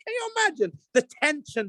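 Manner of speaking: ordinary talk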